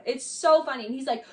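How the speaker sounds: ordinary talk, nothing marked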